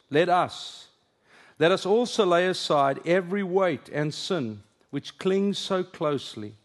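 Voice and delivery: slow, solemn scripture reading with lifted phrases. Acted. Preacher reads Hebrews to the congregation, slow and solemn. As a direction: read the passage slowly, weighing each line.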